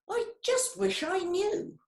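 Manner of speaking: high, small wishing voice; wistful wish. Acted. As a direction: sigh it out like a hopeful little lizard.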